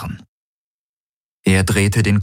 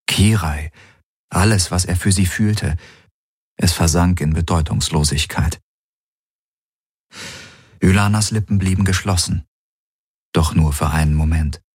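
ordinary delivery

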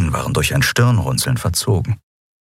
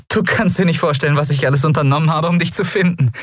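Endriel relieved; 10 semitones higher, faster than usual. Acted. Endriel erleichtert, fast lachend.

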